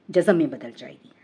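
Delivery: ordinary talk, nothing marked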